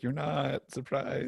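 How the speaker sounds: a light impression of a mom springing a prank; teasing mom. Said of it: "she's like".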